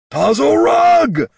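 high drawn-out shriek of a name. A narrator's reading. Orc chief shrieking his sister's name. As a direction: shriek the name, long and loud. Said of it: "Darek shrieked".

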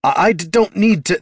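high, loud, stuttering objection; flustered, tripping retort; stammering protest. A narrator's reading. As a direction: protest hotly and get cut off. Son stammers protest, cut off.

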